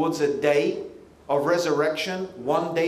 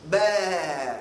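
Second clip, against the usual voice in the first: drawn-out mock sheep's baa; sheep bleat. Imitates sheep bleat for crowd followers.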